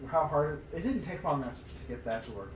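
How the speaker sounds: ordinary talk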